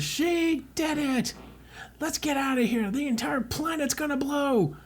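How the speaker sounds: high, hammed-up urgent cries; excited shouting. Everyone fleeing the exploding planet, high excited alarm. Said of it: "then everyone says".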